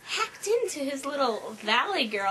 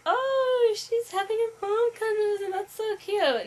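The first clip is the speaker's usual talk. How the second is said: high, loud cooing imitation; gushing onlookers; 10 semitones higher, 5 dB louder than usual. Imitating people reacting to mom's visit, gushing. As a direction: gush over it in a high voice. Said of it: "People were like".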